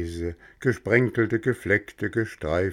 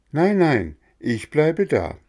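higher, louder double no; loud refusal; 8 semitones higher, 5 dB louder than usual. Acted. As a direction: push the temptation away. Bengele refuses.

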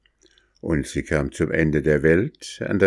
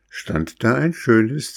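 plain reading voice

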